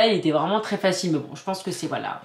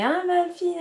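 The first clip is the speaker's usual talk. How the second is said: mock gushing mom; 12 semitones higher than usual. Mother showing how she didn't embarrass her daughter, mock high squeal. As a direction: pretend to gush over your daughter, voice soaring.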